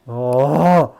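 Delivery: loud sudden gasp of alarm; startled cry. Real, not acted. Gamer startled by the game, loud cry. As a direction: yelp at a shock.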